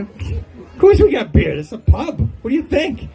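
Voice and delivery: high-pitched mock indignation; indignant pub staff. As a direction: retort as if the question is absurd, pitch up. Pub staff answer a silly question.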